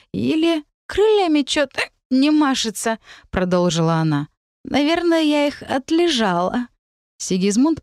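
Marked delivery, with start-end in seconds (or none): 0.1-3.0 pixie. Acted. Fairy complains, hungover. 4.6-6.7 a small sleepy fairy whining; pixie. Acted. Fairy complains, hungover.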